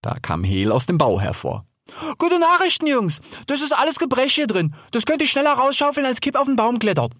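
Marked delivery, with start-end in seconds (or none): 2.2-7.1 high, excited announcement; cheerful Hel the boar. Acted. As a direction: burst out with good news in a high voice. Hel brings good news. (aufgeregt, mit hoher Stimme)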